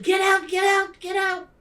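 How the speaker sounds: high, loud panicked scream; screaming get out. Retold or imitated. Other teacher screaming at the new boy, loud and high. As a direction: shriek it at the boy to leave.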